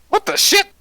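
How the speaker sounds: high, loud startled yelp; shocked outburst. Acted. Fleming shocked as the cat turns humanoid. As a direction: jump out of your skin. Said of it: "And Fleming goes".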